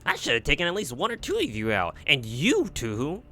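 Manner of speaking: villain baffled by the survivors; exasperated villain. Acted. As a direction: scoff at the unscathed heroes. Villain figure incredulous.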